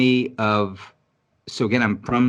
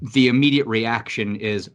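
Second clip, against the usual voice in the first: usual speaking voice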